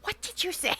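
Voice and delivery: shocked coworker. Retold or imitated. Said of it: "they'd probably be like".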